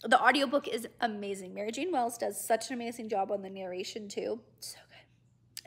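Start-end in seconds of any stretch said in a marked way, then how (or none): 4.6-5.6 whispering. Real, not acted. Unvoiced aside.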